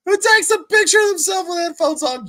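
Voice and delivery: high, loud disbelieving yell; incredulous shout. Real, not acted. Ranting at photo, incredulous.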